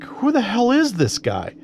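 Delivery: high-pitched retold disbelief; incredulous reaction. Mechanic under the car wondering about a stranger, incredulous. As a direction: act out baffled indignation without shouting. Retelling his bewildered reaction to shouter.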